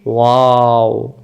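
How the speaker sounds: ওয়াও held long with rounded lips; ওয়াও stretched very slow from both lips; drawn-out waw. Shown as a demonstration. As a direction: model ওয়াও slowly for the class. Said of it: "এবার আমরা শিখব ওয়াও".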